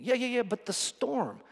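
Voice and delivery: high, dismissive yeah-yeah; whiny excuse. Pretend. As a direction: brush it off in a high whine. Disciple excuse, high whine.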